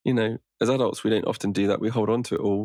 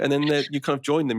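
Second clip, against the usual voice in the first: usual speaking voice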